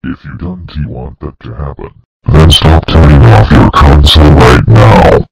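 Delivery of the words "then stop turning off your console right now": full-blast jump-scare scream. Acted. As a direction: blast it at the listener. Final jump-scare yell.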